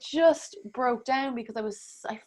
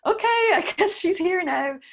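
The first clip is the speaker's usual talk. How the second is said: loud, high retold 'okay then'; surprised acceptance; 9 semitones higher, 6 dB louder than usual. Woman recounts the child climbing into her, startled acceptance. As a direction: accept the surprise in a raised, louder voice.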